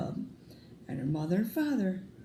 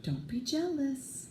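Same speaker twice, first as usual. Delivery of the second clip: higher, softer mother's voice, slow; soothing mother; 8 semitones higher, 4 dB quieter, slower than usual. Acted. Mother soothing jealous Kristen, soft higher coaxing. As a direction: coax her out of jealousy.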